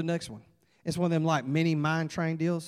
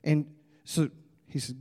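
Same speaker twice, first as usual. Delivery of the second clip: plain reading voice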